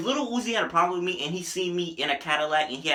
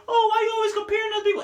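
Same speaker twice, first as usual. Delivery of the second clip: high, fast mocking whine; mock critic; faster than usual. Answering an imagined critic in chat, high and mocking. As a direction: put on a nagging critic's voice. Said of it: "I know".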